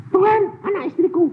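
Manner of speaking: high loud anguished plea to God; pleading cry. Retold or imitated. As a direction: cry out for your family. Crying out to God. (seruan memohon)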